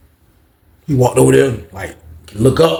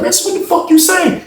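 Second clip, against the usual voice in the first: angry. Retold or imitated. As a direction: bark the question back at him. Heated prison quarrel.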